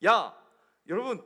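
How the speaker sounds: normal talking voice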